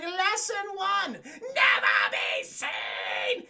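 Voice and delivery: theatrical villain proclaiming the first lesson; Sewer King voice. Retold or imitated. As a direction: drag it out menacingly. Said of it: "And he's like".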